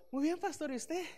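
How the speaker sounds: high, forced sweetness covering panic; fake cheerful family. Family greeting the pastor at the door, hiding chaos, falsely cheerful.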